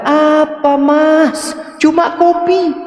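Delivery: high, drawn-up wifely retort; acted wife making light of it; dismissive wife. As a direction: brush off the husband in a high pitch. Wife answers angry husband about coffee.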